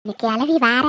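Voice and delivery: ordinary delivery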